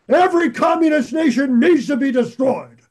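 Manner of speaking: loud, high-pitched mock tirade; acted righteous condemnation, pushed loud and high; mock hardliner outburst. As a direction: declaim it like an outraged hardliner. Mimics a self-righteous hardliner.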